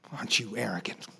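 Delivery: soft, low whispered rebuke; God's conviction as a low whispered rebuke. Retold or imitated. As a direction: hiss the question quietly, close in. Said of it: "the conviction of God".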